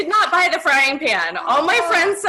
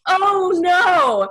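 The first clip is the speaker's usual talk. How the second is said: mock alarmed; 6 semitones higher, 4 dB louder than usual. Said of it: "he's like".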